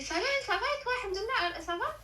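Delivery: a touch of high, giddy cheer; giddy happy voice. Retold or imitated. Friend mimicking her giddy phone greeting. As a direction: sing out the greeting as if over the moon. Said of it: "elle me répond avec une voix, je la reconnais même pas".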